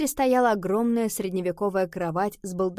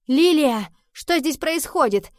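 loud, raised-pitch demand; alarmed cry; 7 semitones higher, 7 dB louder than usual. A narrator's reading. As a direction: cry out sharply at what you've found. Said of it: "вскричала Нова".

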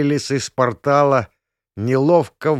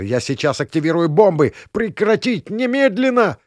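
shouting; 6 semitones higher, 3 dB louder than usual. Acted. Pastor threatens bombs.

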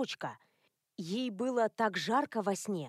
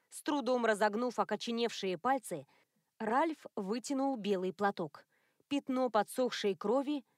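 ordinary delivery

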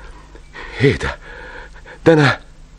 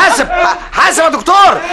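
urgent shout; 12 semitones higher than usual. Acted. Shouting a warning to the doctor as the ship moves off, urgent shout.

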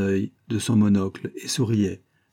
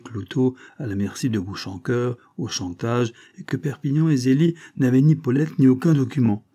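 usual speaking voice

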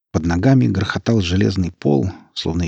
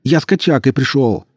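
angry shouting; 6 semitones higher, 4 dB louder than usual. A narrator's reading. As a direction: shout it through the wall. Zhorik yelling for food.

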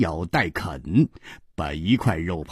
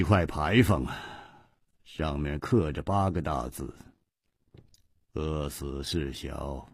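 sneering; 7 semitones lower, 6 dB quieter than usual. Acted. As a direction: sneer it out coldly. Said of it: "冷笑着说". (姬冰燕冷笑着讥讽)

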